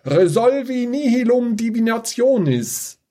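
high, bellowed incantation; bellowed spell. Acted. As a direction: roar out the fire spell. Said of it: "brüllte sie".

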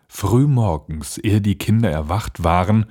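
ordinary delivery, nothing marked

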